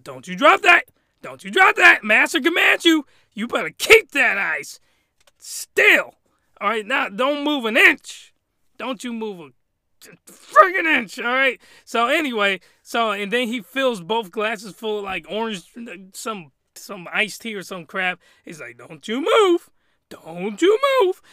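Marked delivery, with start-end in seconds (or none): 0.0-11.6 loud, high, forceful orders; hammed-up drill of commands; commanding master. Voicing master ordering maid. 18.8-21.2 high, loud, drawn-out warning; mock-menacing order; commanding master. Master repeats his order to maid.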